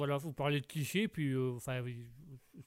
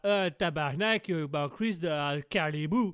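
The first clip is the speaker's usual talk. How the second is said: slow, hammed-up Québécois accent; exaggerated Québécois accent; 6 dB louder, slower than usual. Shown as a demonstration. Québécois guest shows what he doesn't say, exaggerated Québécois accent. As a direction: drag it out in a caricature Québécois accent. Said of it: "Je ne dis pas".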